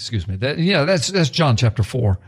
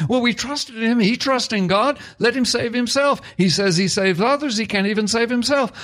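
higher, jeering voice of a scornful bystander; imitating the mockers taunting a helpless man; mocking crowd; 9 semitones higher than usual. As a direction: sneer it like the crowd at the cross. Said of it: "The people that were mocking him were saying".

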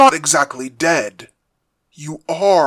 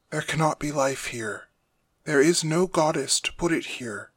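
soft, measured, grave explanation; quiet captive father; 8 dB quieter than usual. Acted.

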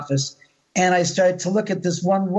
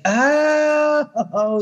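high drawn-out oh; sudden realization; 10 semitones higher than usual. Retold or imitated. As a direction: it suddenly clicks. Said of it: "And I was like".

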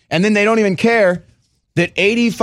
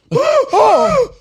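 mock horrified gasp; 4 dB louder than usual. Mock gasp of horror at mask.

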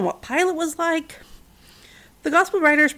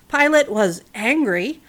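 usual speaking voice

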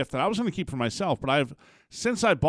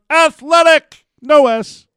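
shout; 11 semitones higher, 13 dB louder, slower than usual. Pretend. Tail of the chant.

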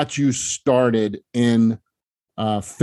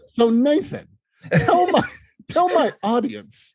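mock interviewer; 12 semitones higher than usual. Playing like he doesn't know.

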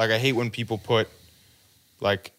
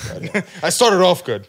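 laughing; 6 semitones higher, 4 dB louder, slower than usual. Real, not acted. Laughing at own tattoos.

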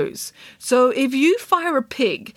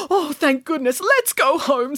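relieved bear; 7 semitones higher than usual. Acted.

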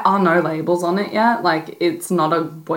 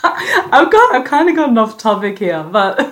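real laugh breaking through, high; laughing at herself for rambling; 6 semitones higher, 4 dB louder than usual. Real, not acted. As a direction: giggle through it at going off topic. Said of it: "laughing at herself off topic".

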